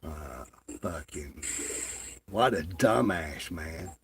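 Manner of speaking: ordinary delivery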